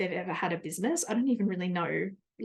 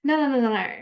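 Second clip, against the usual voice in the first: firm refusal; 4 semitones higher, 6 dB louder, faster than usual. Retold or imitated. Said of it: "I'm like".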